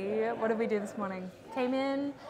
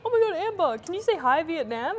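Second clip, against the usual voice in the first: excited fan; 6 semitones higher, 5 dB louder than usual. Retold or imitated. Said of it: "people were like".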